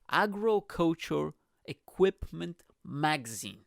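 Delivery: normal talking voice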